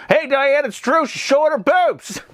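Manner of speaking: high-pitched, hammed-up newscaster; excited mock news report, stretching the ending; mock news anchor. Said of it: "when the biggest news was, like".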